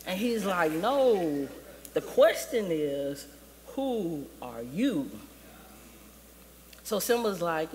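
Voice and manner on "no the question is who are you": slow, drawn-out riddle; Rafiki. Retold or imitated. Rafiki questions Simba, slow and deliberate. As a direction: stretch each word out, teasingly deliberate. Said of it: "we see Rafiki again".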